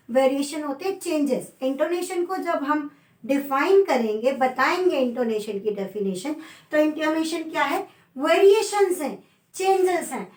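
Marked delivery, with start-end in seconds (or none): none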